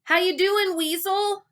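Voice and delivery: high, loud call; shouting. Acted. Gary checking on Wally over the river, loud. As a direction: holler it to a friend nearby. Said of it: "Gary shouted".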